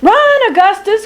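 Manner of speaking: very high call to flee; urgent cry. Acted. Lady Nell urging the prince to flee, high urgent cry. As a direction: urge him to run. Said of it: "cries Lady Nell".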